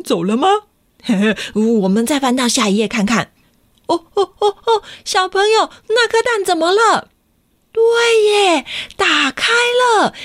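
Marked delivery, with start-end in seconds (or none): 3.9-7.1 surprised. Real, not acted. Egg hatching surprise. 7.7-10.1 excited. Real, not acted. Egg hatches.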